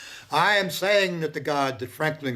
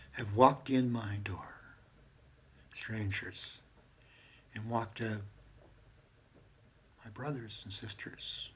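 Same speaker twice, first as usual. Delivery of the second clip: much softer, lower hushed words; soft whisper; 4 semitones lower, 11 dB quieter, slower than usual. Real, not acted. Speaking of strangers who became family, whispered. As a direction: let it fall to a tender whisper.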